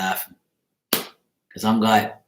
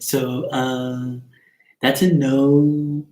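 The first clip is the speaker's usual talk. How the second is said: Jesse; 4 semitones higher than usual. Retold or imitated. Imitating Jesse opening a Pick a Card reading, high mimicry. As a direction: imitate a card reader, pitched up and stretched out. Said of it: "I can do Jesse Pick a Card right now".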